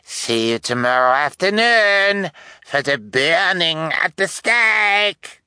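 high, drawn-out villainous call; affected detective's ringing, stretched-out cry; calling out to crowd. A narrator's reading. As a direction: announce it gleefully to a crowd. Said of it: "he called out to the rest of the crowd".

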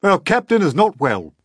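high, loud call for help; officer calls for the doctor; urgent command. A narrator's reading. Officer reports captain unwell.

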